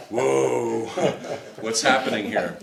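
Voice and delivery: amused startled laugh; laughing whoa. Retold or imitated. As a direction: laugh in nervous disbelief. Jan reacting with a laugh.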